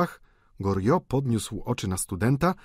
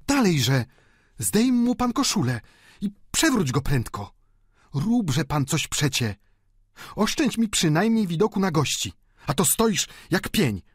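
high, louder, impatient bossy woman; apodyktyczna stara panna; 9 semitones higher, 3 dB louder than usual. A narrator's reading. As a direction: order the helper about impatiently. Michonneau rozkazuje Poiretowi. (wysoki, zniecierpliwiony głos starej panny)

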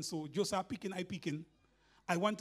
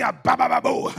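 mock tongues; 6 semitones lower, 4 dB louder than usual. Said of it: "He wanted Elisha to ... say".

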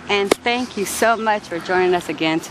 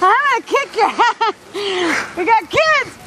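high, loud laughing outrage; just swallowed a bug; 13 semitones higher, 3 dB louder than usual. Real, not acted. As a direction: yell in disgusted disbelief. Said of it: "There's a bug in my mouth".

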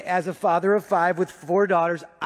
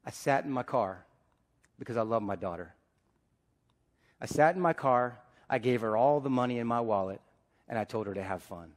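quiet and tender; 8 semitones lower, 7 dB quieter than usual. Real, not acted. Quiet confession about his daughter.

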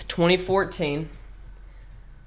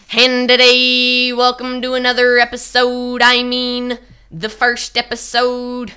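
put-on louder, higher, slow kid youtuber intro; old channel intro voice; 5 semitones higher, 7 dB louder, slower than usual. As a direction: redo your childish first video greeting. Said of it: "let me do it, the iconic line".

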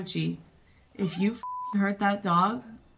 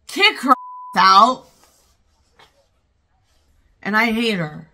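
loud, emphatic order and verdict; angry shouting; 2 semitones higher, 11 dB louder than usual. Real, not acted. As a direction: yell it at the screen. Shouting at film character.

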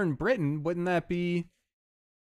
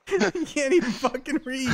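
ordinary delivery

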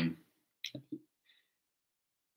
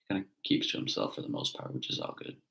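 whispering; 6 dB quieter than usual. Real, not acted. Aside about son, hushed.

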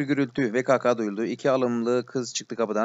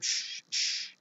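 soft, voiceless "shh, shh"; two hissed shushes; shushing; 6 dB quieter than usual. Acted. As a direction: hush someone urgently. Character hushing companion in dark.

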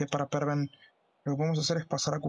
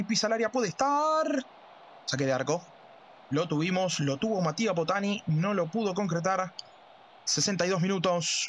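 play-by-play commentary; 4 semitones higher than usual. Acted. Football play-by-play.

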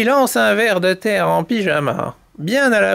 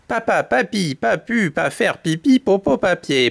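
measured tongue-twister. Shown as a demonstration. Storyteller shows chicks the tongue-twister, unhurried first take. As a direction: recite with small gaps between the words. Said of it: "récitez de plus en plus vite".

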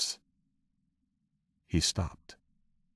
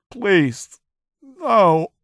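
much higher, louder terrified plea; prisoner's shrill terrified plea; desperate begging; 7 dB louder than usual. Acted. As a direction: beg for mercy in panic. Prisoner begging under torture.

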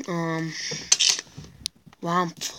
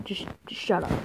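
quieter shush; 2 semitones lower, 6 dB quieter, faster than usual. Pretend. Shushing his duck voice.

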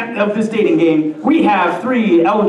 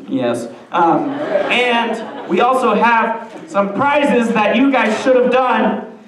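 ordinary delivery

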